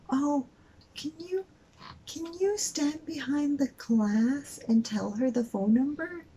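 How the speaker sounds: high-pitched, drawn-out imitation of a cashier; mock officious cashier. Cashier asking a customer to move behind glass, mock officious. Retold demand by annoying cashier.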